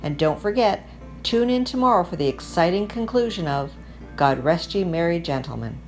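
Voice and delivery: usual speaking voice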